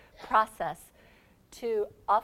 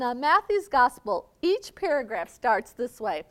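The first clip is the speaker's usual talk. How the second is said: ordinary talk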